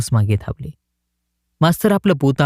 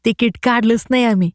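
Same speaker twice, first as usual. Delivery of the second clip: put-on higher boy's voice, stressing नाही with a falling end; the boy Jagu admits to the collector, higher and plain; village boy; 8 semitones higher than usual. A narrator's reading. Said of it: "जगू त्याला म्हणाला".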